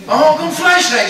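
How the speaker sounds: amazed guest laughing as the meat arrives; mock amazement. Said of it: "Ich so".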